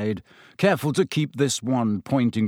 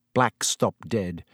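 usual speaking voice

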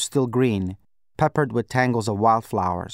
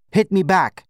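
raised, louder angry command; snapped demand; 6 semitones higher, 4 dB louder than usual. A narrator's reading. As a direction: snap it out as a sharp order. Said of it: "I snapped".

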